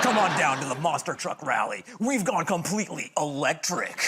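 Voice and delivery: hyped-up ad announcer voice; monster truck ad. Acted. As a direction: sell the rally like a pitchman. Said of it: "let's hear some weird commercials".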